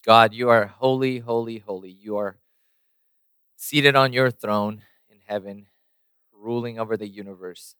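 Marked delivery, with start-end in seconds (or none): none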